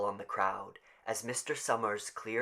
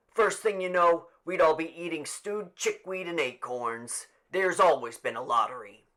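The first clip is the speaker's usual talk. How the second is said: put-on petulant old man; 6 semitones higher, 6 dB louder than usual. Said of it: "he added petulantly".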